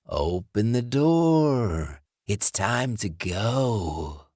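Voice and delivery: slow, breathy rasping whisper of a corpse; undead John. Acted. Dead ex-boyfriend luring Alice, slow rasping whisper. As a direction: hiss it through the door like a dead man.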